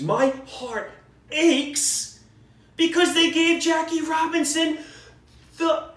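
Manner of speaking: winding up into an indignant rant; mock-anguished, pitch soaring; worked-up rant. Real, not acted. Venting about the Jackie Robinson Parkway.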